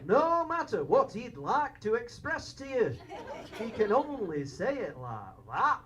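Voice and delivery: mock North of England accent; northern English accent. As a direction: imitate an older northern English uncle. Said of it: "he only talks like that".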